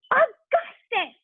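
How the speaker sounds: slow, very high alarmed shout; high drawn-out scolding shout. A narrator's reading. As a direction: call him back in alarm. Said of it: "shouted Missus Gloop".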